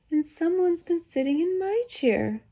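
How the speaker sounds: very high little bear cub; upset storybook baby bear, small and high; wee small Baby Bear voice. A narrator's reading. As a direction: cry out in a tiny, high voice. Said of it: "cried the baby bear in his wee".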